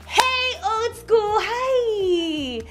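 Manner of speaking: high-pitched, stretched-out hello; gushing greeting. Acted. Streamer greeting a follower on live, gushing. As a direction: squeal a hello to a fan.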